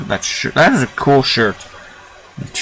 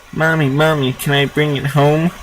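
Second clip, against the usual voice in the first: put-on small child; 5 semitones higher than usual. Young child pleading with mom.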